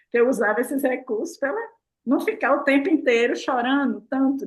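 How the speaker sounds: ordinary delivery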